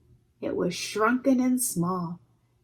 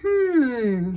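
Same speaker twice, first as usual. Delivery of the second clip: thoughtful hmm; 4 semitones higher, 5 dB louder than usual. A narrator's reading. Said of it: "he said".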